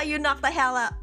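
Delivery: ordinary talk, nothing marked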